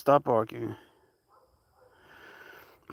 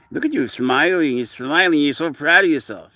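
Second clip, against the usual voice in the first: doting pet talk; 5 semitones higher, 5 dB louder than usual. Real, not acted. Talking to cat, doting.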